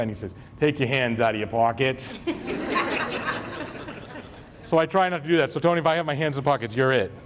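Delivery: usual speaking voice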